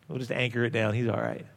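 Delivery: chuckling reassurance to himself; amused inner thought. Retold or imitated. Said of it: "I thought".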